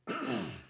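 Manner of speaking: short throat-clearing cough; coughing fit. Real, not acted. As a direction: clear the throat mid-sentence. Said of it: "I apologize for coughing".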